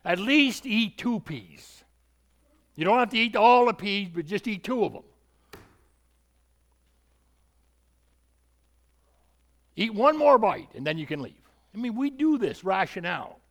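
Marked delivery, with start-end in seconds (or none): 0.0-11.4 put-on pleading parent bargaining with a child, louder and higher; mock parent wheedling at the table; coaxing parent. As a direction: coax a child to eat just two peas. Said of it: "Parents, let's be honest".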